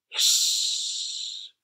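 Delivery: long unvoiced hissing; breathy hiss of water on fire. A narrator's reading. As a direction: steam hissing off the flames.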